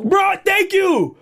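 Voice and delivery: high, drawn-out excited shout; excited exclamation. Real, not acted. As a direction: cry out slowly and high, thrilled. Exclaims when co-host says no.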